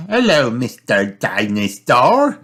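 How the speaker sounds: put-on slow, high girlish greeting; Peppa's voice. Girl greeting the mended toy, slow high girlish voice. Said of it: "says Peppa".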